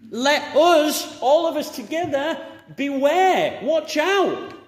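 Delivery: high, loud preacher's warning; urgent warning. Acted. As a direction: warn the whole room, forcefully. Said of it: "corporate responsibility to warn".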